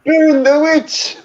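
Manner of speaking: high, slow mob shriek; angry mob shout. Pretend. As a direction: screech it like a peasant mob. Monty Python mob quote.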